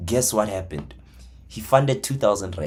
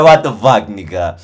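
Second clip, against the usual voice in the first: loud, laughing disbelief; shocked shout; 12 dB louder than usual. Retold or imitated. Trader shouting at his friend's profit. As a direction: yell it again, half laughing. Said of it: "I'm like".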